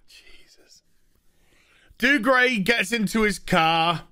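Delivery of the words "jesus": whispered exasperated sigh; whispered weary Jesus. Real, not acted. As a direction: breathe it out in despair. Exasperated at the plot.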